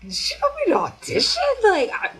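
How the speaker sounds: high, loud disbelief, slowing at the end; incredulous. Retold or imitated. Recalling the audition offer, loudly incredulous. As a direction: retell it in disbelieving outbursts.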